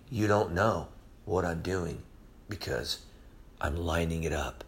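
very low, hushed voice; quiet reassurance. Acted. Preacher voicing God to someone going through something, quiet reassurance. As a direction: sink down soft and steady to reassure.

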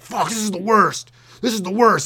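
mock yell of a fed-up rider; imitated yelling. Comedian imitating an angry man on the streetcar, exasperated yelling. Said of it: "This guy was on yelling out".

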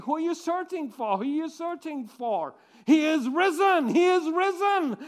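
high, loud jubilant cry; joyful proclamation. Acted. Messengers to the Marys, jubilant and loud. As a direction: announce it with rising joy.

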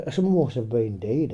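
normal talking voice

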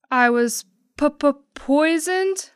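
high, slow stuttering fright; terrified stutter of a poisoned pig; stammering shock. Acted. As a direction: stammer out the horror. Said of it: "Porcini turned lime green".

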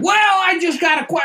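high, loud, squeaky voice; mocking a late questioner. Coworker asks after the silence, squeaky mock voice. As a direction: squeak it out eagerly.

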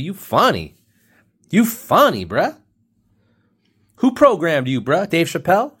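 high, loud, sing-song teasing; playful high-pitched ribbing; mock street slang. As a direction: hype him up with a high mocking bro voice. Mock-imitates comedic slang, pitch leaps.

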